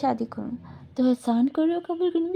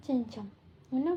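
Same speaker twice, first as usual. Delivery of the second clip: ordinary talk, nothing marked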